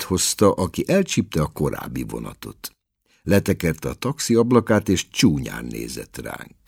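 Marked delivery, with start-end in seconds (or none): none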